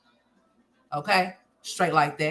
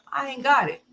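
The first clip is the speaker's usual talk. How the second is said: higher mock whine of her excuse; mocking the mother; 6 semitones higher than usual. Vlogger mimicking the mother's excuse about her kids, mocking whine. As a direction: parody her lame excuse.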